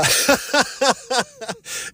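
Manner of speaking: a quick high laugh that falls away. Real, not acted. Recalling getting livid, high falling laugh. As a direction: laugh off your own rage.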